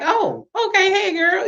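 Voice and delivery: high-pitched, thrown-off hello; awkward greeting. Retold or imitated. Greeting a coworker met unexpectedly by the elevator, awkward and high.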